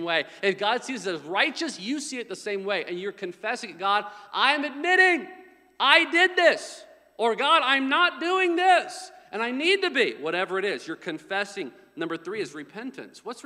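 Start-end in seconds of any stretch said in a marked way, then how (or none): 3.8-10.1 emphatic confession. Acted. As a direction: cry out an admission, drawing the words out. Acted confession to God, preached.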